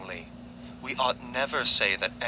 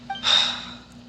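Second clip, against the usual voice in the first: weary sigh. Acted. Sigh before a long story.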